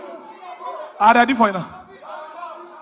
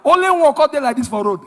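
normal talking voice

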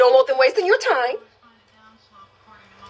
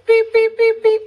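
high louder beep beep imitation; censor beeps; 9 semitones higher, 3 dB louder than usual. Streamer mock censoring a guest, high beeps.

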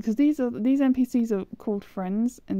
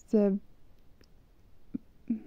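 ordinary talk

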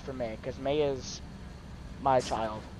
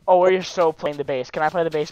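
usual speaking voice

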